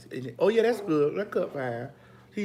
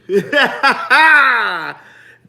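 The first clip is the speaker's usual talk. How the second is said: loud laugh; 8 semitones higher, 13 dB louder than usual. Real, not acted. Big laugh on the live.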